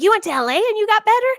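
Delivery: high, fast mock disbelief; mock incredulous asker. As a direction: toss off the skeptic's question in a put-on voice. Mocking what people ask her.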